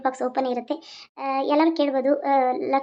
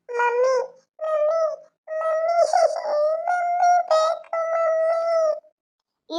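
put-on very high, drawn-out toddler wail; crying little girl; 14 semitones higher, much slower than usual. Woken child calling for her mummy, high whining cry. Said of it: "ಅಳ್ತಾಳೆ ನೋಡು ಕಳ್ಳಿ".

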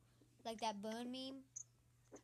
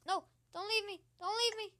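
high, louder desperate plea; panicked pleading; 7 semitones higher, 5 dB louder than usual. Real, not acted. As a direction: beg not to be left. Left behind in game.

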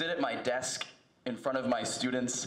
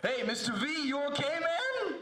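put-on high, rising, casual teen calling out; higher student's voice, climbing on "man"; 12 semitones higher than usual. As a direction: play a concerned kid checking on the teacher.

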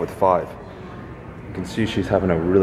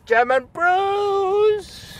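very high, long-drawn singsong; playful crowing; much slower than usual. Pretend. Vlogger showing off picked-up brews, playful crowing. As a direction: stretch it out in a silly high voice. Jokingly celebrates the beer bought.

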